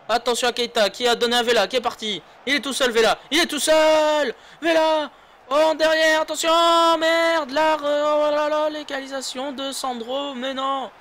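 high, loud urgent call; excited shouting. Real, not acted. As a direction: yell the chance as it unfolds. Chance then conceded goal.

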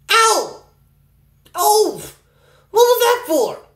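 hurt and indignant; indignant yelp. Real, not acted. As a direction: protest being hit. Hit by a friend.

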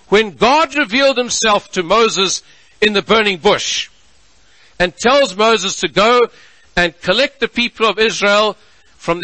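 a pulpit sermon in full flight; full-force preaching. Acted. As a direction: preach it out to the congregation. Preaching the trial of Jesus.

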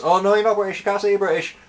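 a high mock voice for the person who objected; high, sneering imitation of a critic; mocking objector. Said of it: "It's just".

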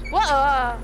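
drawn-out shocked whoa; startled whoa. Real, not acted. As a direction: caught off guard, loud. Monster grabs teammate.

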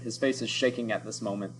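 ordinary delivery, nothing marked

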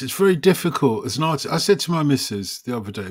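normal talking voice

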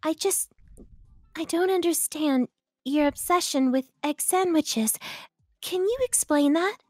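soft, high character voice, hesitant start; Juno. Acted. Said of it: "Have Juno and Legoshi argue about food".

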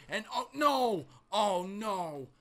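shocked commentator yelling oh no; alarmed cry. Real, not acted. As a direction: cry out as your man goes down. Wrestler hit off the ropes.

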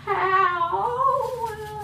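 sharp high ow from cold; pained cry. Real, not acted. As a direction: yelp at the freezing ice. Ice cold on hand.

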